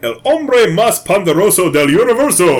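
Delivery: careful, halting Spanish phrasebook reading. Shown as a demonstration. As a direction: read the Spanish out like a tourist. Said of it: "Read that second line".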